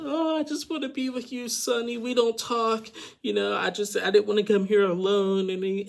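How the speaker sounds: hammed-up high pleading girlfriend voice. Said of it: "you got Vea just running".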